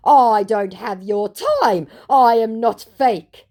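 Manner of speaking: slow, high, emphatic mock protest; hammed-up huffy declaration; indignant scammer. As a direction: play the affronted scammer, drawing words out. Voicing the scammer's last word.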